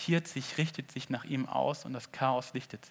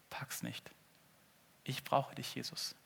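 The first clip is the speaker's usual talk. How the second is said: soft breathy whisper, barely voiced; whispered admission; 11 dB quieter than usual. The preacher admitting to the congregation he can't manage, whispered. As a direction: confess it under your breath. Self-mocking confession aside.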